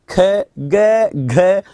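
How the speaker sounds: ख and घ said with a strong puff of air, ग with little; aspiration demo. Shown as a demonstration. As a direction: sound out ख, ग, घ so the breath on the hand shows. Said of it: "अब बोलिए कै". (हवा के ज़ोर का अंतर दिखाते हुए ख, ग, घ)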